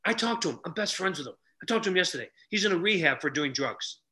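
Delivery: ordinary delivery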